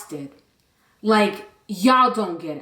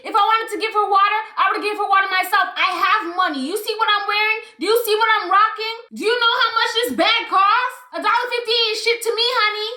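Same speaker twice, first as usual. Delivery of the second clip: imitating a snobby rich woman, higher and fast; mock her bragging, pitched up and rapid; 8 semitones higher, faster than usual. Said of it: "She's like".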